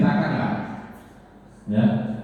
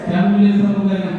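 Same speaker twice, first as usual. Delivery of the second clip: loud, quick call to the whole class; raised voice to get the class to answer; 7 semitones higher, 5 dB louder, faster than usual. Real, not acted. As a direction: call it out across the room. Teacher calls out to class.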